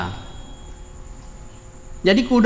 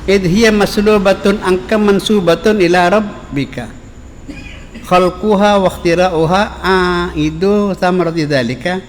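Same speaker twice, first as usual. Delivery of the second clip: forceful Arabic recitation; 3 dB louder than usual. Acted. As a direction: declaim the Arabic like a preacher at full voice. Recites Arabic text at full force.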